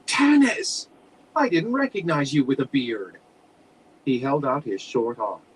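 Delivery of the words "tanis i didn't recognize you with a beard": startled high cry of his name; surprised gasp. A narrator's reading. Old friend spotting Tanis, startled and high. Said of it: "he gasped".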